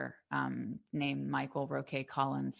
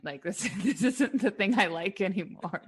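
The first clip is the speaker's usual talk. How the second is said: laughing; 5 semitones higher, 4 dB louder than usual. Retold or imitated. Retelling the architecture school frustration.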